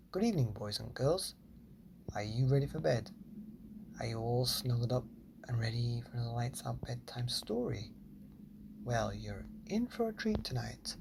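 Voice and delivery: put-on gentle, quiet hello to children; softly greeting the listening children; soft bedtime greeting. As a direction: tuck them in with your voice.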